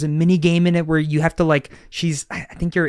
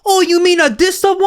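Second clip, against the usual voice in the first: put-on high, loud hammy Italian accent; over-the-top fake Italian accent; awful Italian accent; 8 dB louder than usual. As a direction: play Sly smugly showing off a bad Italian accent. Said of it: "awful Italian accent".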